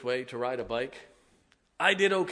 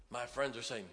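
ordinary delivery

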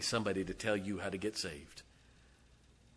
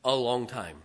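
loud preacher's cry, louder; fiery preaching; 6 semitones higher, 16 dB louder than usual. Real, not acted. Preacher warning his church, at full force.

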